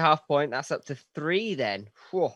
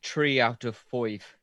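slow, badly faked Irish accent; drawn-out mock southern Irish; attempted Irish accent; slower than usual. Shown as a demonstration. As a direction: have a clumsy go at an Irish accent. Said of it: "Is that an Irish accent you were attempting".

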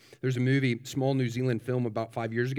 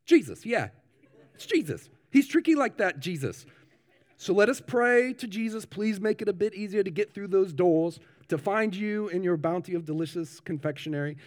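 higher-pitched New Zealand funeral minister; New Zealand accent; 6 semitones higher, 3 dB louder than usual. Acted. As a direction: wrap up the sermon in a put-on New Zealand accent. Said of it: "And the minister says".

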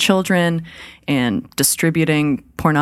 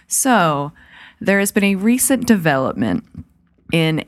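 news segment intro; 3 semitones higher than usual. Acted. Host opening the horror news for listeners, announcer style. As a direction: open the segment like a news anchor.

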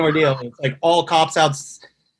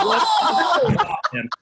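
excited. Real, not acted. Punchline of the fight story.

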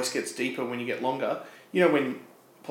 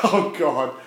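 laughing exasperation; 7 dB louder than usual. Real, not acted. Laughing at the innuendo.

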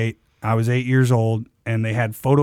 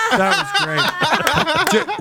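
drawn-out praise; 14 semitones higher, much slower than usual. Retold or imitated. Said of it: "he went".

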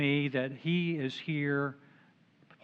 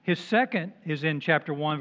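normal talking voice

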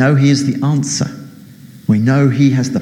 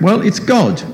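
voice from above; 8 semitones higher than usual. Acted. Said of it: "And the voice came back".

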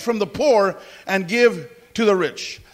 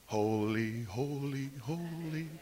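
low, much softer, fading hushed reverence; reverent hush; 8 semitones lower, 16 dB quieter, much slower than usual. Retold or imitated. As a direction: breathe each holy more softly than the last. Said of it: "So I thought".

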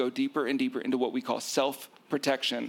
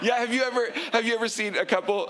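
much higher, laughing start; 9 semitones higher than usual. Real, not acted. Host laughing at co-host's joke, higher. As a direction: laugh into the question.

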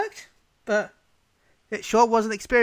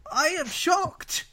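mock shock; 9 semitones higher, much slower than usual. Sarcastic mock shock at own podcast.

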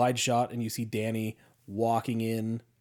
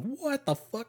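disgusted; 13 semitones higher, 4 dB quieter, slower than usual. Retold or imitated. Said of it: "he fucking looks disgusted".

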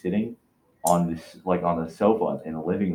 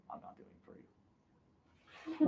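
hushed retold refusal; 15 dB quieter, much slower than usual.

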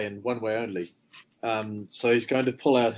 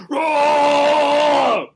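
long, high, loud war scream; battle roar; 10 dB louder than usual. Acted. Loris charging up at the goblin, full-throated roar. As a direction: roar it out and hold it. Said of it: "loud scream, making himself known".

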